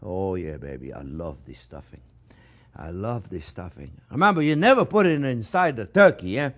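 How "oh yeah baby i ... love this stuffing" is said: low murmur. Real, not acted. Tastes stuffing, low murmur.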